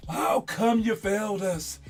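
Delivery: a teammate letting you down gently but loudly; mock teammate. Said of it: "your teammates going".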